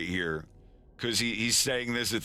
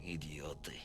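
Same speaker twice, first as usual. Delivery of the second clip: Idiote mumbled much softer, barely voiced; under his breath; 4 semitones lower, 12 dB quieter than usual. [name] insults the brothers, mumbled under his breath. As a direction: mutter Idiote under your breath to yourself. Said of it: "a little under his breath".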